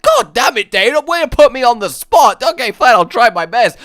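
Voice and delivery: loud, high put-upon protest; hammed-up grudging outburst; mock exasperation. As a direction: grumble loudly at being put on the spot. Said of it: "then you're like".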